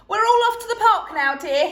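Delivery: loud fast cheery announcement; announcing. A narrator's reading. Dad announcing trip to wife, loud cheery. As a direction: burst in with news. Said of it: "he announced".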